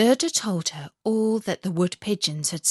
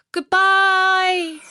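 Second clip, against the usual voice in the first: high, loud farewell call; calling goodbye as the reindeer leaves; 11 semitones higher, 7 dB louder than usual. Acted. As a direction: call it out after her, pitched high. Gerda calls farewell to robber girl.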